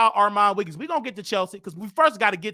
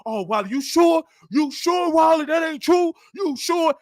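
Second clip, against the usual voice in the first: frantic high pleading; 5 semitones higher than usual. Retold or imitated. Frantic friend begging confirmation.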